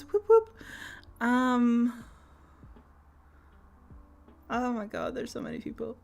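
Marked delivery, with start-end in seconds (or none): 0.0-0.5 high, loud whoop; cheer. Real, not acted. Streamer cheering happy Pride to chat.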